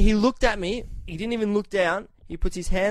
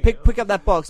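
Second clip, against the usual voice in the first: louder barked order at the movers; bossy order; 2 semitones higher, 7 dB louder than usual. Retold or imitated. Ordering movers about loudly, a bossy command. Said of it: "I was saying it to Kenji".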